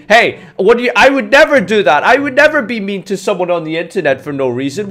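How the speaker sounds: high, loud mock outrage; indignant normal person. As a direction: play the offended innocent. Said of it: "Normal people would say".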